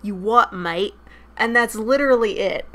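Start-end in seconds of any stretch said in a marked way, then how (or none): 0.0-0.9 rough attempt at a British accent; amateur British catchphrase voice; slightly British. Shown as a demonstration. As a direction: put on a token British accent, badly. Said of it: "sounds slightly British".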